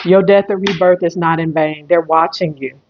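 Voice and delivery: usual speaking voice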